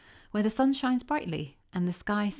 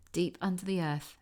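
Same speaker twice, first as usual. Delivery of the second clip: ordinary delivery, nothing marked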